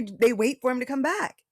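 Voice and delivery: normal talking voice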